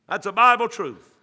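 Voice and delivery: emphatic "That's a Bible truth."; loud. Acted. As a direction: ring it out. Said twice, soft then loud.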